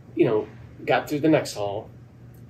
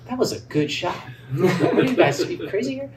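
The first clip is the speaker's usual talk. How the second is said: higher, laughing disbelief at the silent crowd; incredulous laughing protest; 7 semitones higher, 4 dB louder than usual. Retold or imitated. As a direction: laugh it out, baffled they didn't cheer. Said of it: "I'm like".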